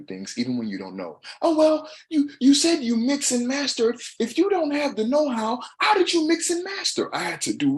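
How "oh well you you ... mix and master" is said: much higher, louder, skeptical voice; mocking doubter. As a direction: mimic a nosy doubter's question. Mimics a doubter's challenge.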